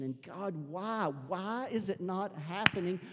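high, drawn-out cry of frustration to God without shouting; anguished why, stretched out and high; frustrated pleading. Acted. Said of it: "Sifting and pressure and frustration".

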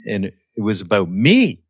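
ordinary talk, nothing marked

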